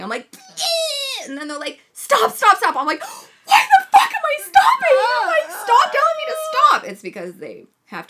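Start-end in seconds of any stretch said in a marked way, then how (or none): 0.6-1.3 very high, strained yeah. Retold or imitated. Quoting herself pushing. 1.9-2.7 high, loud stop stop; urgent shout. Retold or imitated. Nurses quoted again. 3.0-6.8 very high, loud, laughing outrage; laughing angry yell. Retold or imitated.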